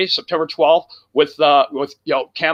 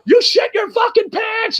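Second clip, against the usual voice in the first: retold yelling. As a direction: holler it like a panicked crowd. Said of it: "Everybody's screaming".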